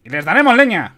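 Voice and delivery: high, loud, thin cry; eager young lieutenant's thin yell; thin-voiced shout. A narrator's reading. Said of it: "gritó con voz fina".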